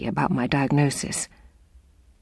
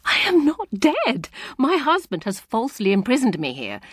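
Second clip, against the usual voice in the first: high pleading protest; insist, close to tears; desperate insistence; 8 semitones higher than usual. A narrator's reading.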